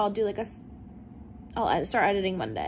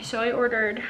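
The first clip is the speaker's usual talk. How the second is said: ordinary talk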